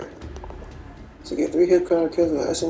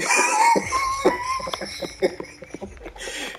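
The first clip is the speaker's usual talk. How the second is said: long high laughing yelp; 4 dB quieter than usual. Real, not acted. Streamer howls with high laughter at the joke. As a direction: burst out laughing.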